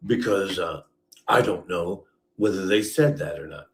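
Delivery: ordinary delivery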